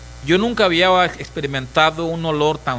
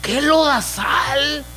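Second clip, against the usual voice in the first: surprised discovery; 9 semitones higher than usual. Acted. Believer with God's eyes sees the world, high and stunned. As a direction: gasp at the mud you never saw.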